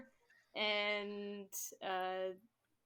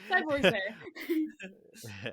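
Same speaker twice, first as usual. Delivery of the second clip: slow, drawn-out elephant beast voice; 6 dB louder, much slower than usual. Acted. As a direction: growl it out slowly like a huge beast. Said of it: "I need my beast voice".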